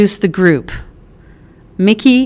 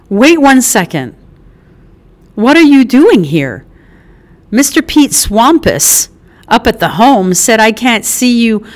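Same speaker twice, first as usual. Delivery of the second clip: Mickey, suspicious mom; 2 semitones higher, 4 dB louder than usual. Acted. Mother questioning why her son showed up, loud and suspicious. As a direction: demand answers like a mother who smells trouble.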